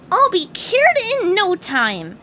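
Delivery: very high squeaky cartoon sponge; chirpy high-pitched sponge, cheerful; SpongeBob voice. SpongeBob praising Doctor Patrick, high squeaky sponge voice.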